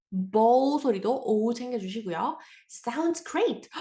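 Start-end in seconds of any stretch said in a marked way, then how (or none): 2.7-3.8 enthusiastic. Shown as a demonstration. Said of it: "표현할 때 우리 sounds great 쓸 수".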